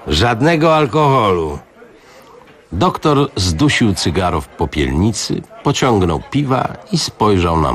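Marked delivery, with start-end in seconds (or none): 0.1-1.6 triumphant. A narrator's reading. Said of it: "dodał triumfująco".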